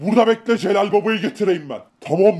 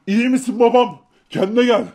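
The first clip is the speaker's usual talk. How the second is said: ordinary talk, nothing marked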